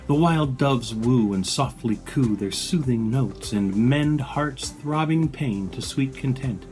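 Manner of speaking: normal talking voice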